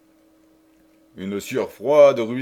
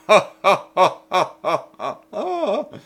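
drawn-out high crazed laugh; long mad laugh; 8 semitones higher, 4 dB louder than usual. Acted. The raving king laughs long and madly at his enemies. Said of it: "parmi des éclats de rire".

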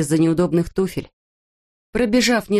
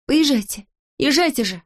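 high, urgent begging; frantic pleading; 8 semitones higher than usual. Acted. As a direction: push him to drive, frantic. Molly begging driver.

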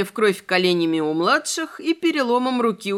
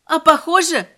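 high, loud exasperated wail; 7 semitones higher, 6 dB louder than usual. A narrator's reading. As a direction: howl it back in despair. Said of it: "взвыла я".

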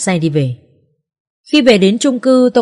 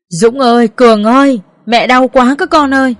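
higher, louder pained wail to her sons; moaning in pain; 6 semitones higher, 3 dB louder than usual.

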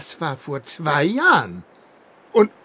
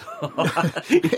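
kurzes Lachen; 2 semitones lower than usual. Acted. Fischer lacht über sich selbst.

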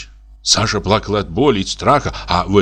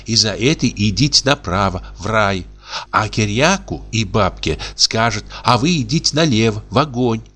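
imitating her mother; 5 semitones higher than usual. A narrator's reading. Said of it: "подражая своей матери".

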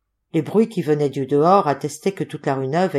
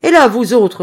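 loud, high hail through a shutter; yelling; 6 semitones higher, 10 dB louder than usual. A narrator's reading. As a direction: bellow out to the men outside. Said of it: "hurla-t-il".

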